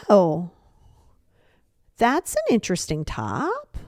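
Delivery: drawn-out, passive-aggressive "interesting" with a pause after "Oh". Shown as a demonstration. Friend reacting to a new outfit, passive aggressive. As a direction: stretch it out with veiled disapproval. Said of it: "Passive aggressive would be like".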